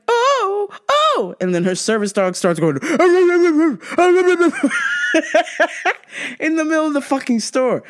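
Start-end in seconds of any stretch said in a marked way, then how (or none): 0.1-1.4 very high gasping oh; high startled lady. Retold or imitated. 2.8-6.3 laughing dog imitation.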